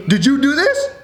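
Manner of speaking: high, mock-outraged mom voice; mom's accusation. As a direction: imitate a mother confronting her kid. Mom confronts son over drawer.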